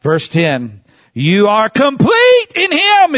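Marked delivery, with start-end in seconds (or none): 1.1-3.2 scripture proclaimed. Acted. Reading scripture with force.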